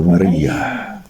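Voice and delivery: low, long-drawn calling of a name; gentle calling. A narrator's reading. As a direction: draw the name out slowly and low. Reads Jesus calling Mary by name.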